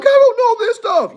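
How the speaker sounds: very high panicked student wail; whiny student. Retold or imitated. Mocking students at test time, high whine. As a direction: whine it like a kid before a test.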